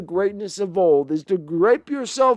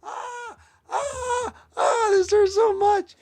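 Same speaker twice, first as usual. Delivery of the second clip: much higher yelping pain voice; mock pained coach; 12 semitones higher, 3 dB quieter, slower than usual. Fan voicing the coach burning, high mock pain. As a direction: whimper like you're burning.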